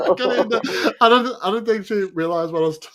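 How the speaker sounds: high, loud laughing through words; real helpless laughter. Real, not acted. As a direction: barely get it out for laughing. Cracking up over awkward podcast moment.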